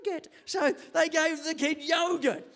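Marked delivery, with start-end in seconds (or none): 0.0-0.3 put-on high, softer child voice; demanding child. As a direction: imitate a little one asking for a treat.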